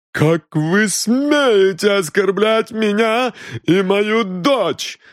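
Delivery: high, slow indignant cry; how dare you, mother's fury; outraged protest. A narrator's reading. (возмущённая мать кричит)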